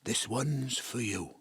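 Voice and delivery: lightly voiced gruff dad; low soft dad; deep dad voice. Spirit replays dad's deep voice.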